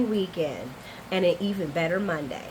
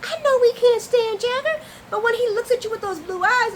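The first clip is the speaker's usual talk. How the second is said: raised, much higher pleading; high-pitched protest; 14 semitones higher, 4 dB louder than usual. Real, not acted. Host defending her crush to viewers, high-pitched and worked up. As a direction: push it up high and insistent.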